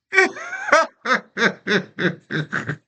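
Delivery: long high falling laugh; laughing at himself. Real, not acted. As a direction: let a high laugh roll down.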